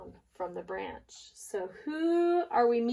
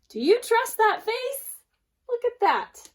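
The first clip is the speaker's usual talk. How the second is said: excited warning; 13 semitones higher, 7 dB louder than usual. Real, not acted. Teacher warns class about fox.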